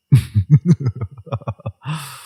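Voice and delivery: a rapid run of laughter, then carry on talking; staged chuckle. Acted. Villain-like character laughs at listener.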